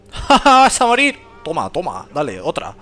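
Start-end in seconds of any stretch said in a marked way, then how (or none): 0.1-0.6 breathy taunting laugh at a beaten foe; gloating ha ha; mock laugh. Mocking laugh at enemy. 0.6-1.2 high, loud playful death threat; hammed-up gamer threat; mock threat. Threatening enemy in game.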